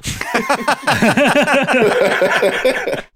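high, loud burst of laughing; loud laughter. Real, not acted. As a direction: crack up loudly.

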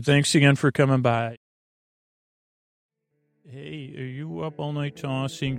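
ordinary delivery

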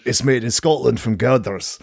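lightly done Scottish ad voiceover; Scottish advert voice. Retold or imitated. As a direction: announce it like a Scottish telly advert. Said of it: "this voice comes on and just went".

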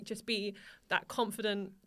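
normal talking voice

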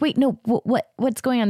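high, flustered confusion; confused surprise. Retold or imitated. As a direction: play the baffled scene partner caught off guard. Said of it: "And he's like".

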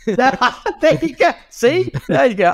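high, loud laughing gloat; crowing with laughter; laughing triumph. Real, not acted. Gloating with laughter that the host is rattled.

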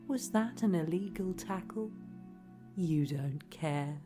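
normal talking voice

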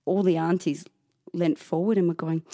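ordinary talk, nothing marked

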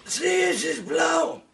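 put-on very high, loud old-man yelp; mock old man. Offered the brazier, the mock old man yelps yes. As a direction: squawk it like a deaf grandpa.

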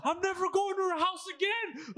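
high-pitched mock tantrum; imitated yelling. Friend imitates son swearing off mom's house, yelling high. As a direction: yell it high, like an embarrassed son storming off. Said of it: "Oh, he's yelling".

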